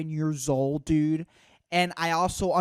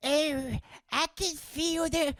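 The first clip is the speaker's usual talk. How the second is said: put-on very high, slow elderly voice; hammed-up old-timer feeling aches in the bones; terrible old-person impression; 11 semitones higher than usual. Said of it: "doing an impression of an old person".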